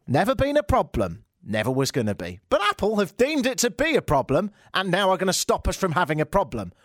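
ordinary talk

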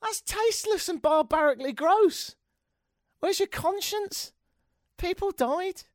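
high-pitched, incredulous outburst; appalled objector. Acted. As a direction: squeal in outrage at something tasteless. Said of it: "didn't someone go".

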